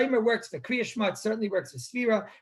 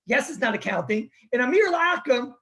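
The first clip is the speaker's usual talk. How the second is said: normal talking voice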